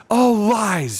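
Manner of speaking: high, loud outburst; loud retort. A narrator's reading. Badger cutting off the dwarf, loud denial. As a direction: cry it out in protest.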